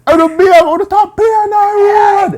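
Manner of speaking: very high, loud acted shout; yelling down the road. People yelling at someone in the road, mock full shout. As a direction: holler it like calling from far down the street. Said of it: "people were yelling down the road".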